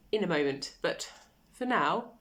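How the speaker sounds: ordinary talk, nothing marked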